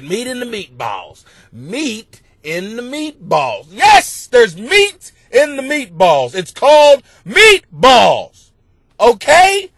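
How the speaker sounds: loud, building exasperated shout; exasperated dad. Retold or imitated. Father scolding his sons at dinner, loud and exasperated. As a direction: lose patience with the kids.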